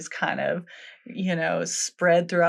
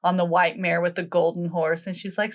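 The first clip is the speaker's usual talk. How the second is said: ordinary talk, nothing marked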